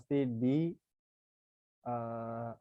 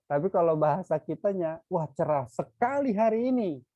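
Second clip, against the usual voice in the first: high, loud and quick, wah cerah sekali; teacher showing how Indonesians exclaim; surprised exclamation; 5 semitones higher, 7 dB louder than usual. Shown as a demonstration. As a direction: exclaim it like a pleased surprise at the weather. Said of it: "Like surprise".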